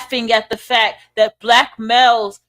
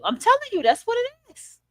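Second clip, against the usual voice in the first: high-pitched insistence; 12 semitones higher, 4 dB quieter than usual. Real, not acted. Telling viewers how they are seen, high insistence. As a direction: insist in a much higher voice.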